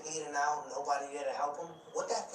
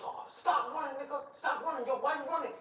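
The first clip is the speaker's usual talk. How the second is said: higher, calling after him down the street; mock yelling; 10 semitones higher than usual. Retelling the guy chasing him down the street, mock yelling. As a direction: holler it like a chaser from behind. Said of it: "All you hear is".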